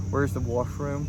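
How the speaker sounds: light Canadian accent, softened vowels. Shown as a demonstration. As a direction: ask it like a Canadian. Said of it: "I started saying washroom".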